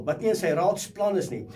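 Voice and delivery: ordinary talk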